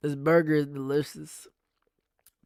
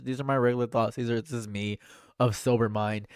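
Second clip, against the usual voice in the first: ordinary delivery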